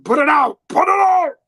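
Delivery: high, loud mock-panicked shout; comic frantic Dracula outburst; flipping out. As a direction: yell it in hammed-up panic, rising on the repeat. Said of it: "he flips out".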